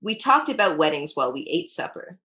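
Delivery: usual speaking voice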